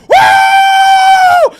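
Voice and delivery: very high, loud, long whoop; wolf-like whoop. Real, not acted. Streamer celebrating a Wolves goal, wolf-like whoop. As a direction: let out a huge wolfish "woo". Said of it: "making noises like a wolf".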